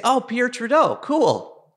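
high-pitched, pleased exclamation; retold excitement. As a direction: react brightly to a sudden discovery. Retold delight at spotting poster.

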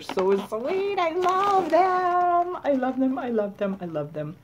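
high squeal that comes down step by step; coo, then settle back down; cutesy squeal. Real, not acted. Vlogger coos over ghost stickers, pitch falling with each repeat.

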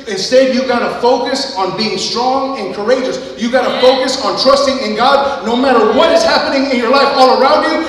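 building to a loud, high shout; full-force preaching. Acted. Pastor urges congregation to trust God, building loud preaching.

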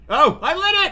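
high loud delighted cry; thrilled it worked; excited cry. Real, not acted. Player managing to light the candle, excited cry.

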